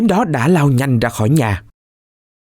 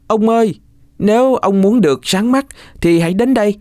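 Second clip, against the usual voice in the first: put-on higher, coaxing boy's call; 8 semitones higher than usual. Cuội calls to the blind man, coaxing call. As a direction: lure the old man over. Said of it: "Cuội liền gọi".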